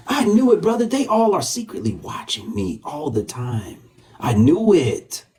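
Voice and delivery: high, drawn-out crowing; gloating triumph. Real, not acted. Host crowing to brother about secret watchers, gloating triumph. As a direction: crow in vindication, stretching the words high.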